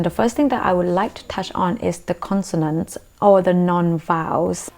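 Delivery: ordinary talk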